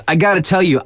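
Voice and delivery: ordinary talk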